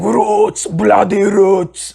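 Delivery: put-on slow, drawn-out Brazilian thrash accent; lightly mimicked Brazilian shout. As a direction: imitate a Brazilian metal singer, stretching each word. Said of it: "His Brazilian accent very".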